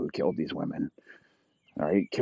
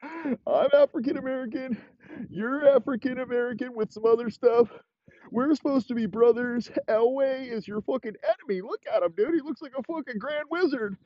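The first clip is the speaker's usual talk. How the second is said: high, strained put-on voice for Teddy. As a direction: play Teddy high and pleading. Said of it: "fucking Teddy's like".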